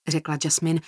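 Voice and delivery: usual speaking voice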